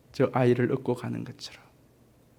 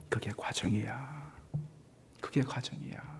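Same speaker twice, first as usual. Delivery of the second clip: whisper; 3 semitones lower, 16 dB quieter than usual. Acted. Jesus answers softly.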